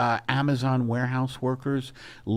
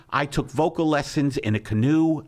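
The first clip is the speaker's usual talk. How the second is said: ordinary delivery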